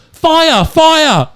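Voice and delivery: loud, high warning yell; mock alarm shout. Blonde shouting at the returning firing squad, mock alarm.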